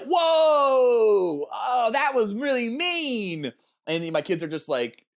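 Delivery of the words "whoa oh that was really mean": shocked whoa. Retold or imitated. Watching a turtle killed beside his kids, shocked whoa. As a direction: act out your own shock at the screen. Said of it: "I was like".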